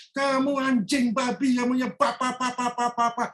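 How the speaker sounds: hammed-up tirade of abuse; wife berating the maid, mock shouting; mock furious scolding.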